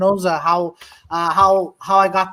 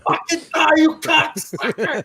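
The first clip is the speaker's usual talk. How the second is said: mock menacing actor; 6 semitones higher, slower than usual. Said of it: "Massimo's like".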